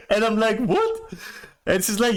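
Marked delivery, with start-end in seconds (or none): 0.1-1.1 surprised. Retold or imitated. Shocked reaction in retold story.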